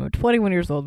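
ordinary talk, nothing marked

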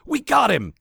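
high, loud, excited cry. A narrator's reading. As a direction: blurt it out with glee. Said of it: "Terry says excitedly".

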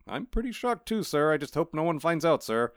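cat embarrassed before Death; cat, shocked. Acted. Cat voiced answering Death.

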